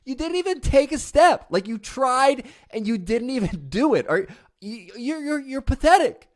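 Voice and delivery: put-on high, loud sneering ridicule of a toddler; taunting a baby who fell, scornful and high; mocking scorn. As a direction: jeer at the baby like a cruel critic. Mocking a baby's first step.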